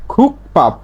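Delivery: খুক পাপ as two separate beats, p not b; corrected p reading. Shown as a demonstration. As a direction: say খুক পাপ with a voiceless p starting পাপ. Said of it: "তাহলে উচ্চারণটা এরকম হবে".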